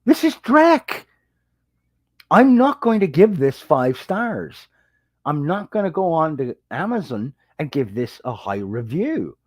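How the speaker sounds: retold indignant outburst. Reviewer refusing the writer's story, retold indignant outburst. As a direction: scoff at the story as trash. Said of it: "I'm like".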